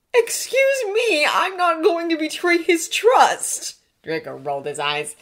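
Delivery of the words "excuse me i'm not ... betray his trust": loud, slow, high protest; indignant defence. A narrator's reading. Draco defending his silence to Hermione, affronted. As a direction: stretch it out, affronted.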